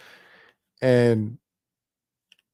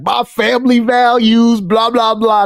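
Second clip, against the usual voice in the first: mock-pious politician, pitched up; mocking quote; 5 semitones higher than usual. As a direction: parrot the campaign line dismissively, raised pitch. Mocking a hypocritical politician's campaign.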